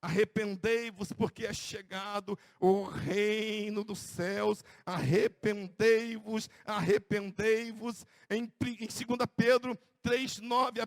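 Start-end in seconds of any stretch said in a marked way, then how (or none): 0.0-8.0 drawn out, grave call to repent; slow solemn proclamation. Acted. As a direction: proclaim it slowly like a prophet. (proclamação lenta e solene)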